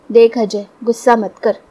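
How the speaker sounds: ordinary delivery, nothing marked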